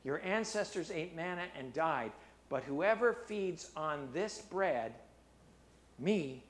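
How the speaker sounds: measured preaching read of Jesus' words; scripture reading. Acted. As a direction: read the passage with gravity.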